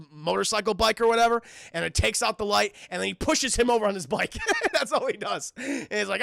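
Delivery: usual speaking voice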